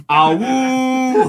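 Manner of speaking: loud howling woo; wolf howl. Acted. Wolves howl greeting.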